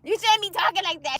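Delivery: ordinary talk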